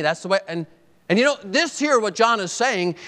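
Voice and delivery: ordinary talk, nothing marked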